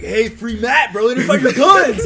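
high, loud mocking tease; teasing friend. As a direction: call it out across the class laughing. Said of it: "He's like".

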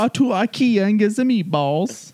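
high sing-song mock Swedish accent. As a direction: tease about IKEA in a goofy Swedish plumber voice. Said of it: "sounded like a Swedish plumber".

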